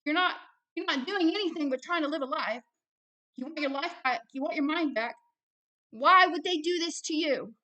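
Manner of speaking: loud, high, heated insistence; real, worked-up anger; mad. Real, not acted. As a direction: fire the challenge at them in exasperation. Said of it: "I'm mad".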